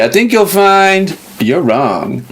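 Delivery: slow, smug know-it-all lawyer. Retold or imitated. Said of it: "he kinda talked the same way".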